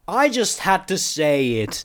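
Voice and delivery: high, drawn-out exasperated call; shouting. A narrator's reading. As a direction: call it over a noisy horde. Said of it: "I shouted over the chittering".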